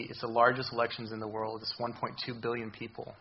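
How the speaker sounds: ordinary talk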